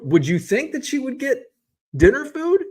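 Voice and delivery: incredulous rhetorical question. Real, not acted. Mock disbelief at wife's shopping.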